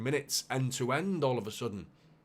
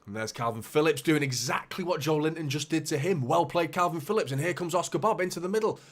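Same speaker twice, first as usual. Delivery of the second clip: louder, higher, rising with the play; raised excited match call; excited commentary; 5 semitones higher, 6 dB louder than usual. Real, not acted. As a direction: call it like it just happened. Live commentary on a good play.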